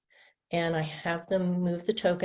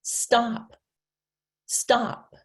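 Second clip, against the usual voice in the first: crisp, raised model of stop; teacher modeling stop; clear model word; 5 semitones higher, 4 dB louder than usual. Shown as a demonstration. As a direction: say stop clearly for the child to copy.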